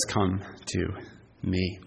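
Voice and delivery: low, soft, with a pause before the last word; gentle invitation. Retold or imitated. Preacher quotes Jesus inviting listeners, gently. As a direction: let each word land softly and low.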